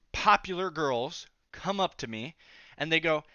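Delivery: usual speaking voice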